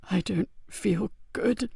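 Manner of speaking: soft, slow frightened murmur; fading voice; wounded sergeant whispering fear; weak and afraid. Acted. Dying man afraid.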